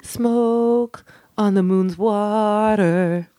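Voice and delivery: drawn-out spacey storyteller; slow dreamy voice. Pretend. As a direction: float it out slow and strange. Absurd quote in odd voice.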